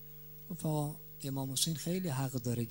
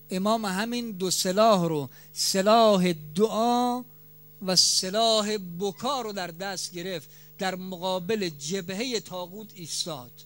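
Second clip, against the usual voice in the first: raised, loud sermon climax, stretched words; impassioned preacher, pitch raised high; preaching at full force; 5 semitones higher, 7 dB louder than usual. Real, not acted. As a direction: drive it home from the pulpit.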